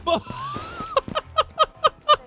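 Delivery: high squealing laugh at the chaos; burst of laughter. Real, not acted. Streamer watching a truck fly into cars, squealing laugh.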